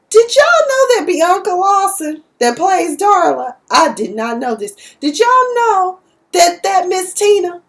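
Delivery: normal talking voice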